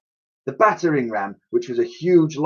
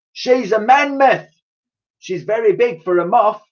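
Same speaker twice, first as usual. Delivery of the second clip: put-on old lady correcting; 10 semitones higher, 3 dB louder than usual. Dotty correcting Raj.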